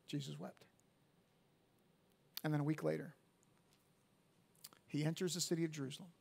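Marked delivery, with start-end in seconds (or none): 0.1-0.7 much softer, falling away; hushed refrain. Acted. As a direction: say it gently, barely above a breath. Refrain after verse story.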